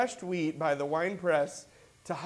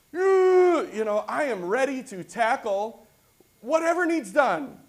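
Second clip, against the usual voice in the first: eager; 7 semitones higher, 8 dB louder than usual. Real, not acted. Preacher enthusing about readiness.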